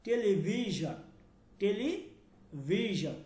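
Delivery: টেলিভিশন with a buzzed zh for the s; English-style zh sound in টেলিভিশন; zh in television. Shown as a demonstration. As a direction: say টেলিভিশন with "vee-zhan", drawing out the vee. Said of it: "এই ধরনের উচ্চারণ হয়".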